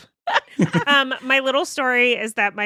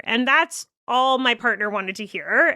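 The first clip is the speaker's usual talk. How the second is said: normal talking voice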